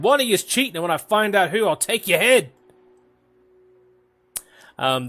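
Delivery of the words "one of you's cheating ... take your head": higher, louder threatening dwarf; agitated threat. Acted. Dwarf accusing fellow gamblers of cheating, angry threat. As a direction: threaten the whole table, pitched up. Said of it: "he seems very agitated".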